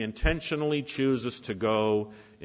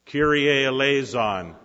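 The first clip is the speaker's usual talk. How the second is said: loud, higher chanted plea; preacher leads the Kyrie eleison side; leading the call; 4 semitones higher, 7 dB louder than usual. Shown as a demonstration. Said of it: "You start".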